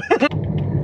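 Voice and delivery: high breathless wheeze of laughter; wheezy laugh. Real, not acted. Laughing at the punchline.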